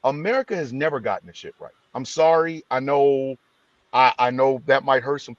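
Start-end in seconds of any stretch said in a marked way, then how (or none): none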